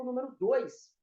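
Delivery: ordinary talk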